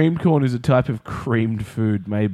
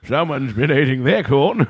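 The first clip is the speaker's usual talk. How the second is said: lightly voiced old camp counsellor; 3 semitones higher, 4 dB louder than usual. Camp counsellor finding the corn kernels, mock old-timer. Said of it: "the old camp counsellor going".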